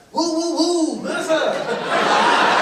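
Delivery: high-pitched cheering woos; mock whooping. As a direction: whoop like an excited audience member. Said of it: "They're whooping and cheering".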